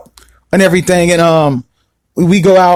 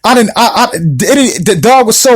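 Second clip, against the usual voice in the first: ordinary talk, nothing marked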